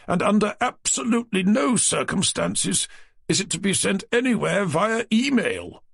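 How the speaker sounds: wagging a finger over rules; fussy publisher. A narrator's reading. Naylor laying down conditions.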